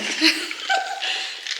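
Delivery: high breathy giggle; a quick high laugh. Real, not acted. Laughing at her own joke.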